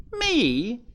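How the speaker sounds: high-pitched, incredulous question; astonished retort. A narrator's reading. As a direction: shoot up high in disbelief. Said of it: "retorted the jay in astonishment".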